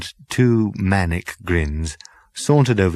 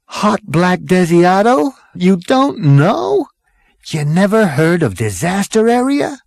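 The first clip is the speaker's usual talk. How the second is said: incredulous Zaphod; 10 semitones higher, 4 dB louder than usual. Acted. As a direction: stretch it out, stunned anyone asks.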